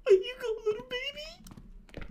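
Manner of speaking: high, whiny frightened pleading; scared whimpering. Real, not acted. Streamer too scared to turn round in the game, high frightened whining. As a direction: squeal with fear.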